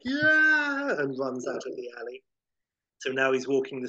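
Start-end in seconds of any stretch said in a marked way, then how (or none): 0.0-0.9 very high, loud panicked yeah; panicked shriek. Retold or imitated. Howard fleeing the bum with a very high, loud panicked shriek. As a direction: shriek it and bolt. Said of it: "And Howard's like".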